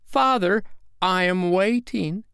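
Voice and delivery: put-on high, slow child's reproach; cold. A narrator's reading. Michael waiting on his father, coldly. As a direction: press him coolly as a waiting boy. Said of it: "said Michael coldly".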